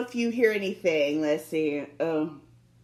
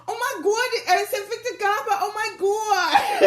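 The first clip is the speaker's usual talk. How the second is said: starstruck gasp; 11 semitones higher, 4 dB louder than usual. Retold or imitated. Spotting Victor Garber at the ATM, high starstruck gushing. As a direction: gush like a starstruck fan. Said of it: "and then I said".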